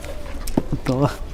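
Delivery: soft unvoiced giggle; breathy laugh. Real, not acted. Ada giggling shyly when stuck for an answer, soft giggle. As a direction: let the answer dissolve into a shy giggle.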